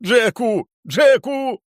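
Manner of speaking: very high wailing shout; shrill desperate howl. Acted. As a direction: howl his name in panic. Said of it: "завив він".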